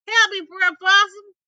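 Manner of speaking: high, loud call from far off; a desperate cry for help, loud and high; distant cry for help. Acted. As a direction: shout for help from down the road. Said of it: "he heard something".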